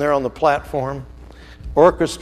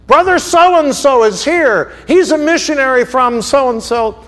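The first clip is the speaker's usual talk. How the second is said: pulpit announcement; 10 semitones higher, 10 dB louder than usual. Acted. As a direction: call it out like a pastor presenting a guest to the congregation. Pastor announcing guest from pulpit.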